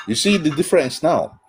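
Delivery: normal talking voice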